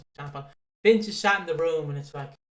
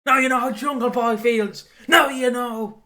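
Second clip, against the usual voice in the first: much higher and louder mock rage shouting; yelling at the TV; 8 semitones higher, 8 dB louder, slower than usual. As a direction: scream abuse at the wrestler on screen. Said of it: "and I'm like".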